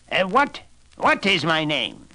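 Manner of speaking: booming triumph; thundering little man. Acted. The little man demands his prize from the queen, loud. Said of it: "he thundered".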